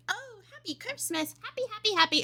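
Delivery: high, sweet, sugary mock cheer; mock cheery singsong. Streamer mimicking her first cheery reaction, high and sugary. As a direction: chirp it like a cartoon holiday greeting. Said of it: "At first, it's like".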